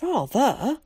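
high, delighted exclamation; thrilled, high-pitched agreement; enthusiastic cry. A narrator's reading. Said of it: "cried Zack".

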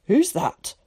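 mildly sharp demand. A narrator's reading. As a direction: snap the question into the dark. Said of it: "asked Tom sharply".

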